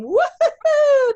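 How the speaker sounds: long, high, loud woo-hoo; excited whoop. Real, not acted. Host celebrating the Bowie team, slow high woo-hoo. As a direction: stretch the whoop out slowly in celebration.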